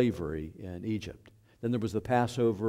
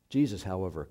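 ordinary talk

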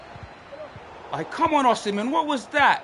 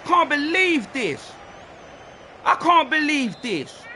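disbelief; 7 semitones higher, slower than usual. Real, not acted. Reacting to a missed chance.

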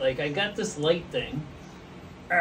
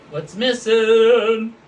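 louder, slow drawn-out what's missing; game-show tease; 4 semitones higher, 9 dB louder, much slower than usual. Pretend. Host quizzing viewers on the flag, loud drawn-out tease. Repeats quiz question.

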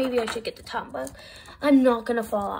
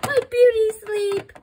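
whiny; 10 semitones higher, 6 dB louder than usual. Pretend. Woken too early.

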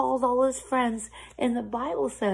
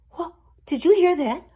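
loud, higher-pitched question; excited alarm; 8 semitones higher, 8 dB louder than usual. A narrator's reading. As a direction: burst out at the distant sound. Excited at hearing the call.

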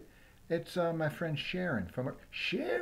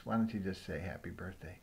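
ordinary talk, nothing marked

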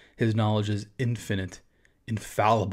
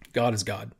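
ordinary talk, nothing marked

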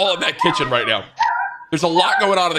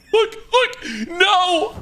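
heartbroken crying; much slower than usual. Real, not acted. Child losing a pet goldfish, heartbroken wailing. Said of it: "the kid is heartbroken".

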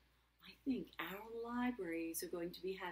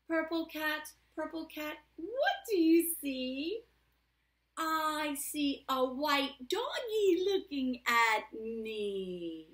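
louder, stretched sing-song answer; reading to children; 5 semitones higher, 12 dB louder, much slower than usual. A narrator's reading. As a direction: read the refrain with playful bounce. Reads picture book aloud.

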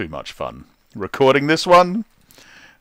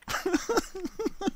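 evil laugh; 8 dB quieter than usual. Acted. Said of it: "That's the original laugh".

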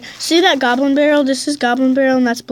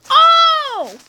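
loud high yelp; high shout; 4 dB louder than usual. Real, not acted. Gamer yelps, high, at three crowns. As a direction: squeal at the win. Winning moment.

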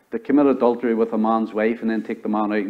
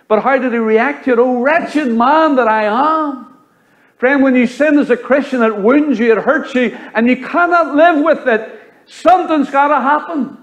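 much higher, louder, urgent appeal; preaching at full force; 10 semitones higher, 4 dB louder than usual. Acted. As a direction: drive it home to the flock. Climactic appeal to the congregation.

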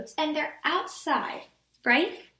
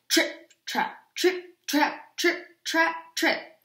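spaced, weighty stomping words; heavy hoof steps; 3 dB louder, much slower than usual. A narrator's reading. Big goat crossing the creaking bridge, heavy steps. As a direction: stomp each word out separately. Heavy third goat's hooves.

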